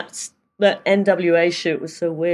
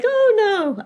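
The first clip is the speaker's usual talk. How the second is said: high-pitched imitated "oh, no"; mock cop reply; 5 dB louder than usual. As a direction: act out the officer's reply, pitched way up. Acting out the police officer's reply.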